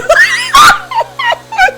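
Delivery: big shrieking laugh; loud high laugh. Real, not acted. Laughing after the shouted chorus.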